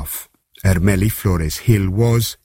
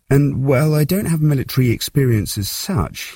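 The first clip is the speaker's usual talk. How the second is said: higher-pitched, hesitant admission; sheepish; 9 semitones higher than usual. A narrator's reading. Teenage barman admitting no military experience, sheepish. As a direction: own up sheepishly in a raised pitch. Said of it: "he said sheepishly".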